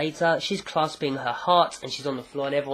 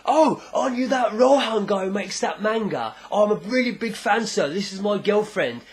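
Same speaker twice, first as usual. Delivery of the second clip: higher, hammed-up starstruck voice; gushing fan; 6 semitones higher, 3 dB louder than usual. Brute recognising a manga artist, mock gushing excitement. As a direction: gush like a big fan meeting an idol.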